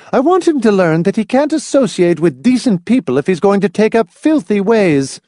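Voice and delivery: high, indignant declaration; indignant mother. A narrator's reading. Mother explaining son's punishment to Hamilton, high indignation. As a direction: lay down the law in disgust.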